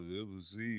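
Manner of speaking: imitated mellow murmur, very soft; mock stoned calm; super mellow. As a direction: drop to a soft, dreamy mumble. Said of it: "between super mellow".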